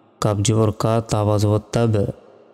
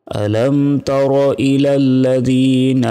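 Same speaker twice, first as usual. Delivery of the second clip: slow, higher chanted tilawat with long held vowels; Quran recitation; 3 semitones higher, 3 dB louder, much slower than usual. Shown as a demonstration. Teacher recites the verse for students, slow and chanted. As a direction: intone each phrase slowly like a reciter.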